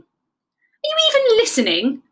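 high, loud, exasperated question; affronted rabbit squeal; peeved. A narrator's reading. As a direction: demand some attention. Rabbit annoyed at being ignored.

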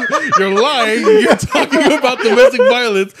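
high, loud laughing accusation. Retold or imitated. Accusing a friend mid-rap, laughing high and loud. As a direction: call him out while laughing hard. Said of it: "And I was like".

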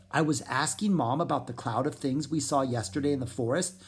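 usual speaking voice

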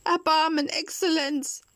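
high begging cry; a last desperate plea; tearful pleading. A narrator's reading. Puppet begging the director, high and tearful. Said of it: "weinte und flehte".

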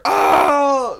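high, loud imitated kids' "Oh"; mock amazed whoop. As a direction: holler like kids stunned by a magic trick. Kids reacting to magic trick.

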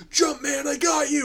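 high loud urgent shout; urgent call. Retold or imitated. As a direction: call out to your partner in a rush. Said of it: "every time he's like".

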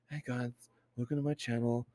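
soft, hushed streamer greeting; quiet parody of an ASMR intro; mock ASMR streamer. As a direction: imitate a streamer murmuring into the mic.

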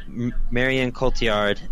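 ordinary talk, nothing marked

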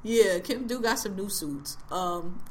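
usual speaking voice

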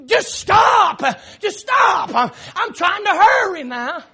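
loud, high acted outburst; frustrated yelling. Dad yelling at kids while packing car, acted frustrated yell. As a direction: yell it in exasperation, hammering STOP. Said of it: "you start yelling".

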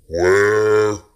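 ghostly creature groaning in the dark. A narrator's reading. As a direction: moan it like a corpse wanting its toe back. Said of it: "heard the voice once more".

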